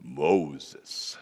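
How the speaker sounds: mock-grand movie God voice; deep Charlton Heston voice. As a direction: call the name like a voice from the burning bush. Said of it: "I can finally be like Charlton Heston".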